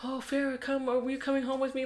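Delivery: hammed-up high plea; mock sweet plea. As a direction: coax her home sweetly. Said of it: "Sharon's like".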